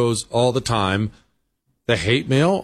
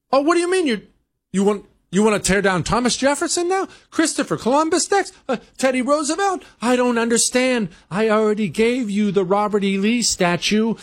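much higher, pleading voice of a caving politician; mock bewildered whine; 10 semitones higher than usual. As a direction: whimper in disbelief as the demands grow. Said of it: "They think that they can just accommodate them".

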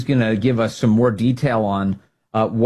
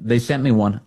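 usual speaking voice

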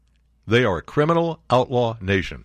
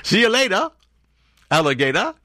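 jokey sign-off; 9 semitones higher than usual. Pretend.

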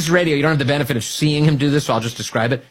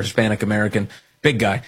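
usual speaking voice

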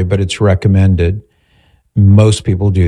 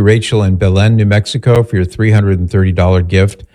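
normal talking voice

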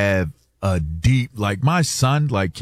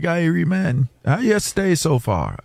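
higher-pitched Jamaican patois; Jamaican dialect; 6 semitones higher than usual. Retold or imitated. Retelling a pilot's greeting to him, imitated Jamaican accent.

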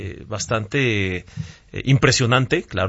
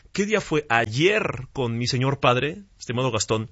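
normal talking voice

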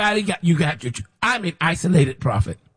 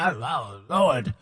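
usual speaking voice

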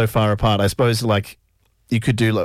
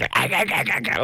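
goblin voice; 3 semitones higher, 4 dB quieter than usual. Retold or imitated. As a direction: screech it like a little goblin. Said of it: "almost goblin voice".

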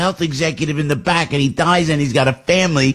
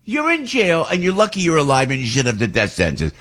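ordinary delivery

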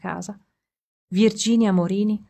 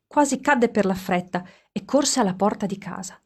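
usual speaking voice

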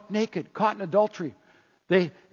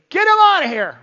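loud, high dismissive yell; annoyed shooing shout; 10 semitones higher, 8 dB louder than usual. Acted. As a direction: shout them away like pests. Disciples shooing away noisy children.

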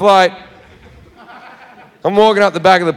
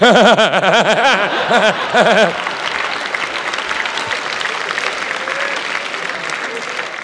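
helpless cackling laugh; the comic cracking up at his own story; long real laugh; 5 dB quieter than usual. Real, not acted.

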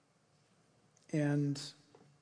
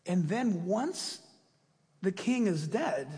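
normal talking voice

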